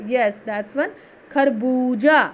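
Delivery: ordinary talk, nothing marked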